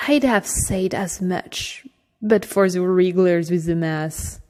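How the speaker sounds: ordinary delivery, nothing marked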